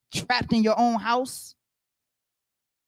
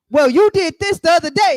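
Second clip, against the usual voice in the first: high, loud mock retort from the wife; mock wife snapping back; 10 semitones higher, 8 dB louder than usual. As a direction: play the wife throwing it back at him, higher and louder. Retold wife arguing back.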